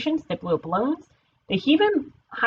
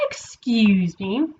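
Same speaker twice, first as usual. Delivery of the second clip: drawn-out polite interruption; much slower than usual. Acted. Idaho addressing the party from the stage, politely drawn out. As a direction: stretch it out very slowly and politely. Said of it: "Idaho said politely".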